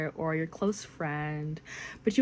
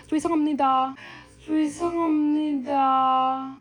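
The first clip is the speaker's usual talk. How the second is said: higher, formal "죄송합니다" with a bow; polite apology; 8 semitones higher, 3 dB louder, faster than usual. Pretend. Bumping into a stranger on the street, formal and higher. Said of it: "bump into a stranger".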